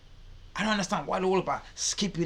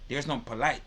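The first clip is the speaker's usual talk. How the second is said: usual speaking voice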